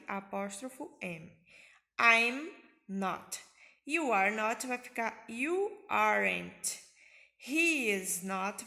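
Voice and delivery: normal talking voice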